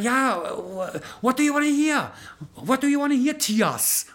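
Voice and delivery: friendly host calling the father by name; imitating Mike. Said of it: "Und er sagt".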